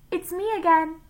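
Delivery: high, loud lunch-lady call; Miss Mush's sloshy voice. A narrator's reading. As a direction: announce yourself in a high put-on voice. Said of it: "voice was like two boots sloshing through mud".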